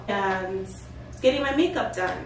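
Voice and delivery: ordinary talk, nothing marked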